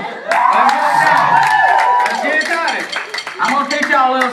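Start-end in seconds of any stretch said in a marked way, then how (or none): 0.0-2.1 stretched shout, louder; hype shout. Acted. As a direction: drag it out to fire up the crowd. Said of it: "Come on".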